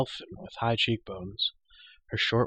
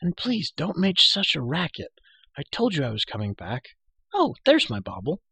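put-on girl voice; 7 semitones higher, 4 dB louder than usual. A narrator's reading. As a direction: hush him like a bossy girl. Elenwy scolding lightly.

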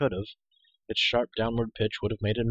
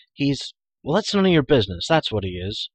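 louder, flustered comeback; indignant retort; 4 semitones higher, 7 dB louder than usual. Acted. Hero defending his friend to the girl, indignant. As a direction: burst out crossly, then drop in pitch.

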